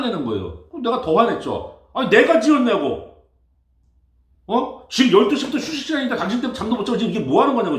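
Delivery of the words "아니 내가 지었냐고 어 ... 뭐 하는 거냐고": retold angry outburst. Retelling his own angry reply.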